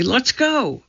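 put-on high, pleading girl voice; little sister begging to go; urgent girl. A narrator's reading. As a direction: tug at him to leave now. Annie wants to leave.

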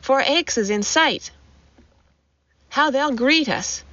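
understated narrated excitement; gasped encouragement. A narrator's reading. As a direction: urge a companion on with lifted pitch. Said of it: "gasped Joris".

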